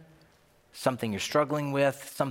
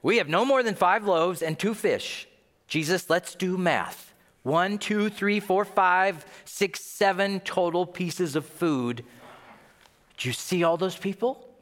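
higher-pitched, sarcastic spelling-out of the obvious; thick sarcasm; 6 semitones higher than usual. Acted. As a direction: count it out as if for someone slow. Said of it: "It's thick with sarcasm".

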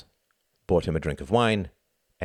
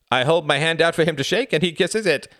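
amused woman's voice; 11 semitones higher, 6 dB louder than usual. A narrator's reading. Helga laughing to room.

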